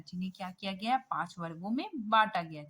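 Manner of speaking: ordinary delivery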